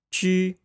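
Ju held high and level with rounded lips; pinyin ju first tone. Shown as a demonstration. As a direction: say Ju on one high flat pitch. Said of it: "Repeat after me".

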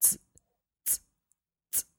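Ts, a bare voiceless ts hiss, repeated; ts consonant sound. Shown as a demonstration. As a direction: make the ts sound alone three times. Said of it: "the consonant group T S".

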